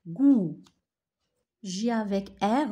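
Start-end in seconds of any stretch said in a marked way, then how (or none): 0.1-0.6 goût much louder, the hard g punched; model word. Shown as a demonstration. Teacher drilling goût for the class. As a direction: shout out goût with a firm g. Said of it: "G avec ou".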